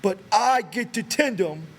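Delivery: put-on high, very slow childlike insistence; insistent Lennie. A narrator's reading. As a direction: drag each word out, stubborn as a child. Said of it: "Lenny broke in".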